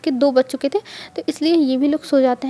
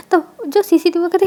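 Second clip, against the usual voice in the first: plain reading voice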